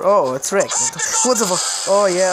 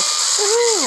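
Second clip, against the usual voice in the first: cheer. Real, not acted. Cheering high.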